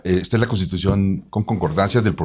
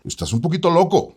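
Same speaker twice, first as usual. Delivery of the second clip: higher-pitched mock reproach; imagined wife's reproach; 10 semitones higher than usual. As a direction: tease him in an imagined wife's voice. Voices the wife's imagined scolding.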